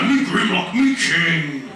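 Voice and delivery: imitated slow, heavy character voice; Grimlock. Fan retelling the actor giving in, imitated slow character voice. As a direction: do a slow impression of the robot king. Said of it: "Do the voice, do the voice".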